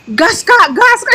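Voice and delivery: put-on high, eager kid brother urging on; little brother voice. Younger brother egging his big brother on, high and eager. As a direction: cheer him on like an excited little brother. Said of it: "dia jadi adiknya".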